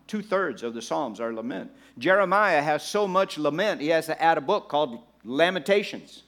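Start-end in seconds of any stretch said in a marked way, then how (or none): none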